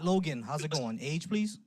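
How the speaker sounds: ordinary talk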